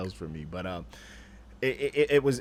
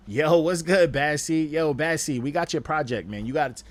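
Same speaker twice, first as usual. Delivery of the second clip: calling out loud and high across the room; loud shout-out; 7 semitones higher, 9 dB louder than usual. Real, not acted. Streamer calling out to Bad Seed in chat, loud hype shout-out. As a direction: hype greeting, voice thrown loud.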